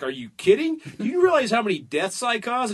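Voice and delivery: indignant boast. Acted. Acted lobbyists one-upping each other.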